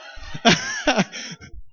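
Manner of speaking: airy burst of laughter; wheezy giggle; amused laugh. Real, not acted. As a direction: chuckle breathily at the absurdity. Laughs that it is too much.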